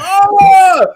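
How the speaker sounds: sudden high loud "Oh!" of shock; shocked cry. Retold or imitated. Retelling a movie scene, a shocked high yelp. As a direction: yelp in disbelief at the screen. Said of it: "I said".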